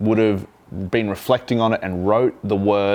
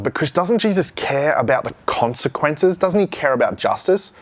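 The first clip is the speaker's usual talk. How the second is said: mock protest; 6 semitones higher than usual. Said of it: "maybe you think to yourself".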